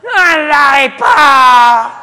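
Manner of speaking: put-on high, loud, slow child's yell; child yelling for candy in the store, loud drawn-out yell; shouting child. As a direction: bawl for candy.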